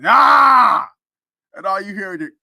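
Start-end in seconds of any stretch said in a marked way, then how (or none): 0.0-0.9 loud, high straining "ah" of a bench press; imitated weightlifter's yell. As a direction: yell like pushing up a heavy bar. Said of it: "hear somebody bench pressing".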